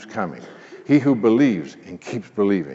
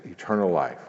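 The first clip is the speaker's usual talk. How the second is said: ordinary delivery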